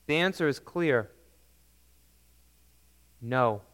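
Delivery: normal talking voice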